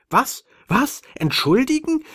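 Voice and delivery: very high, loud outraged squeak; squeaky alien. Acted. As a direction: squeal it in shrill disbelief. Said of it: "quiekte das Männchen ganz rot vor Aufregung".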